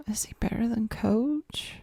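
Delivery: hushed, breathy question; whispering. Pretend. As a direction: whisper it like a secret. Tense ranking, hushed question.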